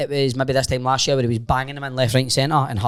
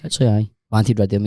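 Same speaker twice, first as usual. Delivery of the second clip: ordinary talk, nothing marked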